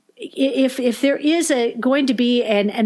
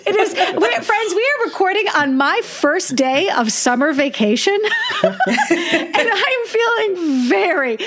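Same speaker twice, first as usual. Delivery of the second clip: higher voice, laughter running through the words; laughing while talking; 7 semitones higher than usual. Real, not acted. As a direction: laugh through it, slowing down at the end. Laughing about summer vacation.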